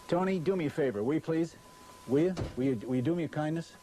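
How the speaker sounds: ordinary delivery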